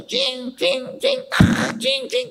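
very high "chin, chin" clinks; knife-sharpening noise. Retold or imitated. Pastor mimics knife on knife, high clinking. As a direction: squeak out the sound of blades scraping. Imitates knives being sharpened.

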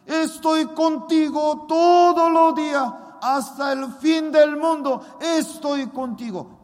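high, loud preaching proclamation; God's promise proclaimed. Retold or imitated. God promising Daniel his presence, loud fervent proclamation. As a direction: shout the promise with full force. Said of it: "me respondía por esa palabra".

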